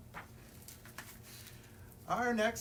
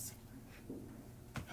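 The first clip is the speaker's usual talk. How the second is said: long breathy tired sigh; exhausted sigh; 13 dB quieter than usual. Real, not acted. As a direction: let out a worn-out sigh. Exhausted after the noises.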